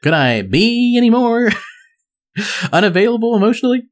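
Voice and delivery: high sarcastic Chandler voice; Chandler Bing impression. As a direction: lean on the words like Chandler. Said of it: "I forgot what Chandler sounds like".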